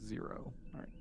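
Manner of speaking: ordinary talk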